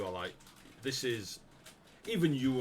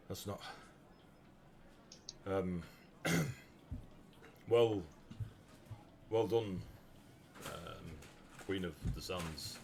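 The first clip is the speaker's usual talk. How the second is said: halting, subdued congratulations; dazed, hesitant acknowledgement of defeat; stunned concession; 3 semitones lower, 6 dB quieter than usual. Acted. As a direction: concede with long, lost pauses. Beaten giant grudgingly congratulates winner.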